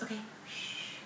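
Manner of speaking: whispered shh; hushing whisper. Retold or imitated. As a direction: hush her like in church. Mom hushing her at chapel.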